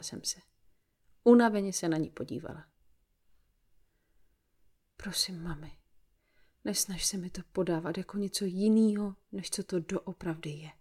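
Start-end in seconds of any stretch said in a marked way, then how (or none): none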